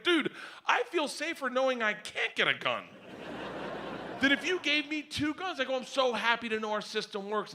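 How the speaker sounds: high, giddy retold delight; high-pitched mock-hysterical joy; hysterical glee. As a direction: gush as if tickled silly. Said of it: "I looked at him laughing hysterically".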